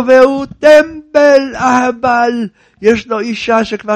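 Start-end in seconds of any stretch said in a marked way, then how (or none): 0.1-2.5 higher, louder, slow scornful rabbi; mock scolding. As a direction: drag out the insult.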